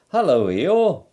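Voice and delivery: cheerful Pooh. A narrator's reading.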